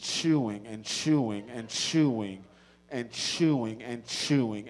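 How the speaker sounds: slow, drawn-out, leaning on each chewing; laboured repetition. Retold or imitated. Comic stuck chewing overcooked meat, slow laboured repetition. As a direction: drag it out like endless chewing.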